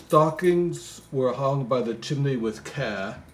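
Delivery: slow, lilting Christmas poem reading; poem recital. Acted. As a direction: read it like a bedtime Christmas poem.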